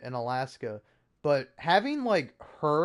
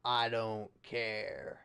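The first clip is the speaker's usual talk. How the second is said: low, slow, flat-out unbothered drawl; mock an American who shrugs off any warning; deadpan indifference; 6 semitones lower, 6 dB quieter, much slower than usual. Imitating Americans, low and slow: I don't care. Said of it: "just go".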